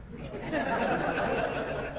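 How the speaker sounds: short unvoiced chuckle; breathy laugh. Real, not acted. Preacher chuckling at his own car crash joke.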